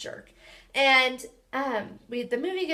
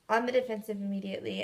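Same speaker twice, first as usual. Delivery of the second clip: ordinary talk, nothing marked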